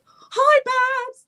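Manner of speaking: put-on high, bright child's hi; high excited little girl calling hello; little girl's greeting. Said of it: "this little girl just was like".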